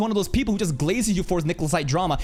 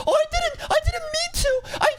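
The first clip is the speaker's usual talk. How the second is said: high, whiny mocking falsetto; mock them in a squeaky grovel. Mocking a critic's apology.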